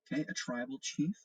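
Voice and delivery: usual speaking voice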